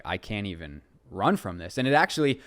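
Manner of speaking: usual speaking voice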